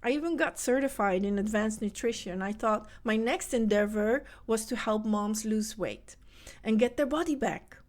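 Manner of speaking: usual speaking voice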